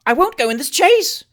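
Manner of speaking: high, loud panicked refusal; shouted refusal. Acted. Trott refusing the chaise in terror, loud shout. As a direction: yell it in rising panic. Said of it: "shouted Mister Alexander Trott".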